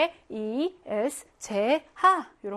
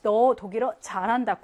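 ordinary talk, nothing marked

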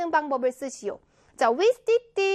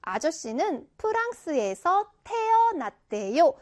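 slow, distinct reading, 태어났대요 spelled out carefully; 또박또박 읽기; slower than usual. Shown as a demonstration. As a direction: stretch 태어났대요 syllable by syllable. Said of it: "이렇게 읽으라는 겁니다". ("태어났대요"를 천천히 또박또박)

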